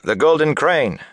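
high, loud cheer; crowd cry. A narrator's reading. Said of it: "Cries of".

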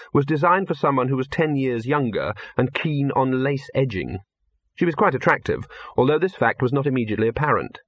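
ordinary delivery, nothing marked

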